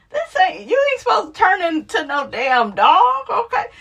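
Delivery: high, mock-outraged scolding; scolding a cat. Telling off a cat that follows her, high mock outrage. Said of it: "I said".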